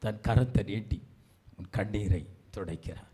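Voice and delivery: low, hushed reassurance; tender comfort. Real, not acted. Preacher says Jesus wipes their tears, tender comfort. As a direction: soften right down to console.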